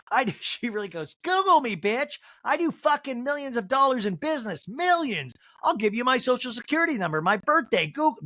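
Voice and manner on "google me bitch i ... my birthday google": high, hammed-up gangster bragging; mock-outraged tough talk, pitched up; Angie K. Angie K boasting to the women, mock gangster outrage. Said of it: "her saying all this does really sound gangster".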